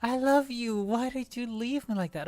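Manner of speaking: high, wailing mock lament; mock heartbreak. Gamer to Gregor who never came home, mock heartbreak. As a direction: plead like a jilted lover, hammed-up.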